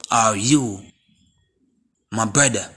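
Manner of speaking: ordinary talk, nothing marked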